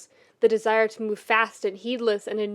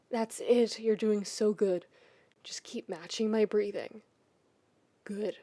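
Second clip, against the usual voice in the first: soothing coaxing; 5 dB quieter than usual. Acted. Shiro calming Keith, coaching his breathing softly. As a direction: coax him gently to breathe.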